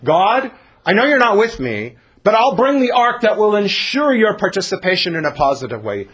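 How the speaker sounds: high, loud naive plea to God; mock prayer. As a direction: put on a pushy bargaining voice. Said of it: "Like when you say to God".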